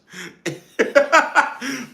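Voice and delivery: loud high-pitched laugh. Real, not acted. At the burnt chicken, loud high laugh. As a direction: burst out laughing.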